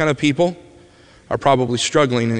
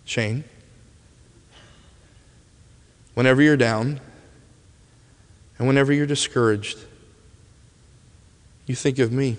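tender; 2 semitones lower, 5 dB quieter than usual. Retold or imitated. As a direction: hand him the words gently, one at a time. He says these words to me.

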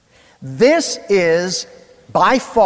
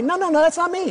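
weary denial; 12 semitones higher, faster than usual. Retold or imitated. Denying identity.

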